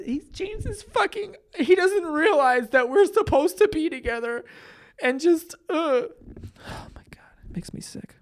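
whiny fake heartbreak that dies away; mock sobbing. Mocking heartbroken girl on show.

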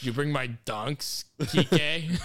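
loud Boston guy calling out; hammed-up Bostonian demand; Boston accent. Boston voice continued.

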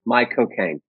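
Michael Caine's Cockney accent, from "my cocaine". Shown as a demonstration. Student trying a taught Michael Caine impression, Cockney accent. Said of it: "Say those two words".

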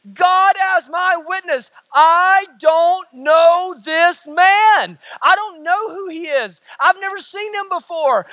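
high, loud, drawn-out oath; emphatic denial. Acted. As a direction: swear you are innocent, stretching each word. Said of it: "beginning to, to ramp that up".

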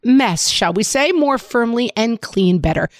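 upbeat sponsor read to a co-host. Acted. Host reading a diaper ad to her co-host, upbeat ad read.

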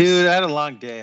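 high and mellow; talking stoned; stoner. Real, not acted. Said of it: "I'm really high right now".

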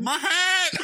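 high, loud hammed-up outcry; mock villain cry. Host mocking Bane losing his hat, hammed-up cry. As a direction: cry out over a lost hat.

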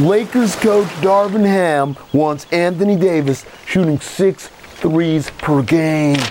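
headline read in surfer drawl, slowing at the end; surfer dude accent. Pretend. Host reading a headline to co-host, surfer accent. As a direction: read the news as a surfer, stretching the last words. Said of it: "I'll still read them in this accent".

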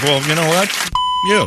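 hammed-up don't-care attitude; defiant dismissal. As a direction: brush them off with spite. Quoting own resolve.

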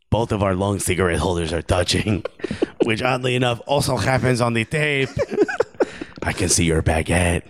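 mock French accent slipping into Argentinian; comic French-Argentinian accent; Karim Benzema. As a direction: put on a wobbly foreign accent, French drifting Argentinian. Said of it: "I promise this is Karim Benzema".

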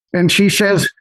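ordinary delivery

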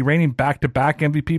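normal talking voice

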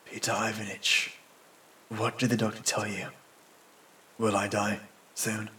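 soft, low, faltering question; weak whisper. A narrator's reading. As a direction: ask faintly like a badly wounded man. Wounded man faintly questions his doctor.

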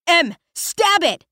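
high urgent scream, slowed. Acted. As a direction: scream the order at her. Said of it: "Latu screams".

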